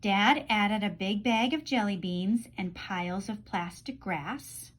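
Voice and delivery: plain reading voice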